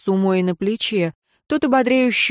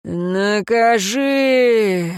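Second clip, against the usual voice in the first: long, drawn-out commanding cry; slow, sustained shout of command; rider's cry; 2 semitones higher, much slower than usual. A narrator's reading. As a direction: call it out, stretching the vowels. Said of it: "закричал всадник".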